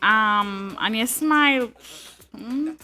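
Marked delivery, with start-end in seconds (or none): none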